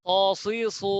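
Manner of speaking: স, সি, সু thick and rounded, with a w-like glide; heavy syllables. Shown as a demonstration. Teacher drilling repeat-after-me, heavy letters. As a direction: keep স, সি, সু full-mouthed, each drawn out. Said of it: "সিনের মতো যেন না হয়".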